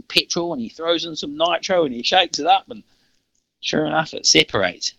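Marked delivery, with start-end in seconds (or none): none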